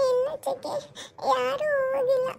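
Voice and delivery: put-on high, slow child's voice, half sob; a little girl gulping between sobs; sobbing little girl. Niece telling her aunt her grandparents left, high sobbing child voice. Niece Ammu crying on phone.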